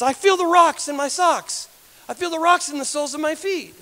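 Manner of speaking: high-pitched, marvelling exclamation; acted amazement. Woman tells those who prayed for her, acted amazement. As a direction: imitate someone amazed by a new sensation. Woman reports feeling in numb feet.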